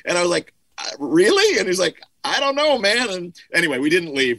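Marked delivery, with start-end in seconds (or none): 0.8-1.6 high, incredulous retold question; mock disbelief. Musician answering producer who wants to flee, mock disbelief. Retells own doubtful reply to producer. 2.2-3.1 very high, wavering retold reply; mock nervous. Producer doubting the visit to his friend, mock nervous squeak.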